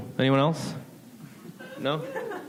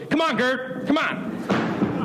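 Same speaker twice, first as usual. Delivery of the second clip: mock urging aunt; 10 semitones higher, much slower than usual. Aunt pushing Gert to walk.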